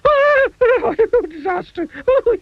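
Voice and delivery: very high, panicked squeal; terrified scream. A narrator's reading. Tiny man waking to a giant, screaming in terror. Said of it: "he screamed".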